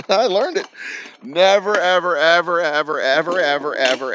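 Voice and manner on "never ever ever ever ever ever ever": loud, high NEVER, then hammered EVERs gradually easing down; emphatic repetition. Real, not acted. Teller driving home the lesson he learned, emphatic repetition. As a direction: hammer every "ever" after a loud "Never".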